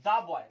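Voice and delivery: put-on 'the boy' with THE said 'duh'; 'the' as 'duh'. Shown as a demonstration. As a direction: say 'the' as 'duh' before 'boy'. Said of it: "आप द बोलेंगे दी".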